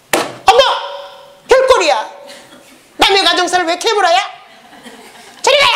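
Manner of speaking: hammed-up outraged lady brushing off a nosy stranger; offended woman. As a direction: play a scandalised gossip shooing him off. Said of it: "보통 사람 같으면 어떻게 해요?".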